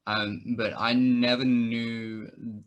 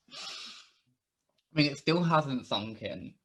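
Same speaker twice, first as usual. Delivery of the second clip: ordinary talk, nothing marked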